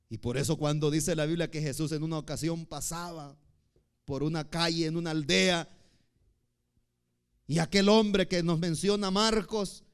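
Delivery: usual speaking voice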